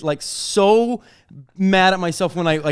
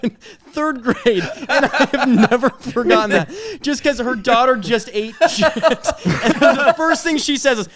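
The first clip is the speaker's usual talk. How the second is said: ordinary talk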